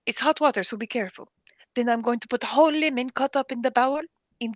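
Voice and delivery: imitated Jordanian boss explaining a remedy; step-by-step instructions in a mock Arabic accent; put-on Arabic accent, insistent; strong Arabic accent. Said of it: "somebody with a strong accent".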